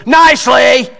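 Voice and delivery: high, loud imitation of a child; little girl's defiant echo. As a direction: mimic a small kid parroting an order at a yell. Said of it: "That's the tone of voice".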